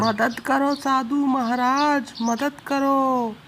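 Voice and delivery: frightened couple begging the sadhu for help; pleading for help. Acted. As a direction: beg the holy man for help.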